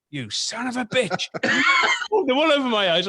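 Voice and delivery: imitated indignant outburst; mock outrage. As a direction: shout it as the fooled man, amused. Retold outrage of pranked Frank.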